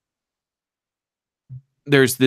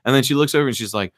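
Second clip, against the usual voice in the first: ordinary talk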